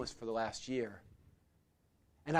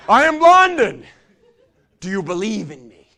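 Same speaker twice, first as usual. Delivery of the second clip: grand, much higher, louder showman's call; 4 semitones higher, 16 dB louder than usual. Retold or imitated. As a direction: play the proud showman to the crowd. Showman addressing the crowd.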